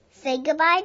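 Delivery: ordinary delivery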